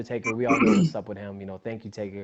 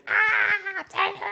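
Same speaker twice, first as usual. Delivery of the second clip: mock fan scream; much slower than usual. Fan as the wrestler comes out, imitated scream. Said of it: "I'm screaming".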